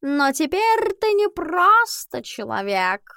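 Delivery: slow, high drawling cat. Acted. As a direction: stretch every word like a lazy cat. Albus the cat speaks.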